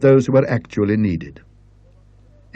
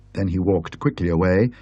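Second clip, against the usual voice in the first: usual speaking voice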